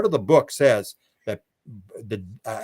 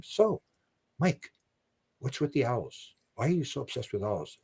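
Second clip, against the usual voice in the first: hushed regression questioning; 10 dB quieter than usual. Retold or imitated. Regressionist questioning Mike under hypnosis, imitated hushed voice. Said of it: "people are just sort of whispering".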